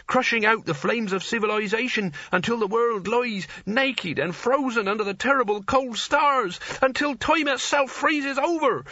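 mounting doom. A narrator's reading. Nigel building doom tale.

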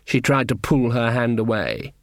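usual speaking voice

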